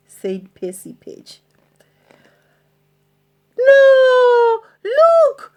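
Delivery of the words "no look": high, loud, fast excited squeal; excited cry. Acted. Peg spotting the treasure chest, high excited cry. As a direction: squeal with delight at the find. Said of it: "cried Peg Polkadot".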